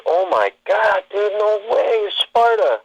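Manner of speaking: retold high-pitched disbelief; starstruck amazement. Fan realizing he met Gerard Butler, retold amazement. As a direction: act out being floored by a celebrity.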